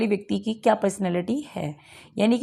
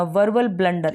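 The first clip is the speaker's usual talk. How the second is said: ordinary talk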